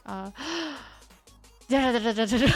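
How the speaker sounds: usual speaking voice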